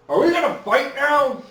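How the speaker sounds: sing-song tease while munching an apple; mocking nonchalance. Houdin taunting the warlord before the duel, high cheeky mock.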